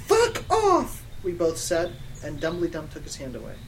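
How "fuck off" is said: high, loud, laugh-tinged snap; mock furious dismissal; angry shout. Students telling Dumbledore to back away, mock angry.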